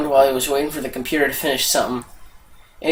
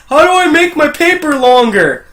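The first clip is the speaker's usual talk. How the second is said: high, loud pleading question; whining student; 10 semitones higher, 5 dB louder than usual. Acted. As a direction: ask loudly in a high student voice. Student asks consultant, in a joke.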